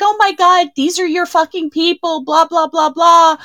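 high gushing friend voice; imitating friend. Retelling her friend's gushing reaction on the phone, high and excited. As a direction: squeal it like an excited girlfriend. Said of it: "And she's like".

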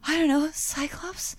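slow, whispered sheepish answer; bashful slow whisper; shy whisper. Retold or imitated. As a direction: whisper it like a nervous fan. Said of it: "And I was like".

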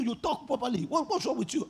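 ordinary talk, nothing marked

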